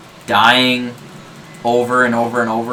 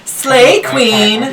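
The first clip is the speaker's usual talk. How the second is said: high, loud laughing cheer; excited cheer; 10 semitones higher, 6 dB louder than usual. Real, not acted. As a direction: cheer him on loudly. Player cheering the girl voice.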